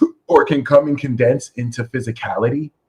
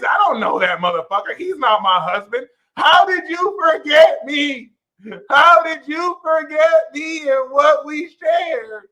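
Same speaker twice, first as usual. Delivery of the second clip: mock heartbroken lover; 13 semitones higher, 3 dB louder than usual. Jilted husband pleading with his Sagittarius wife, high and loud. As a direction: overplay a jilted husband begging. Acting out wife's boyfriend scene.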